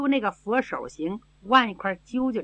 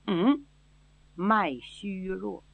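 slow quiet musing; 4 semitones lower, 7 dB quieter, much slower than usual. Acted. Girl feeling the pulse, musing.

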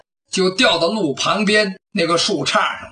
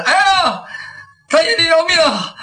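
begging thief; 9 semitones higher than usual. Acted. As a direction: howl for mercy like a cornered coward. Thief begs for his life. (小贼高声哀求饶命)

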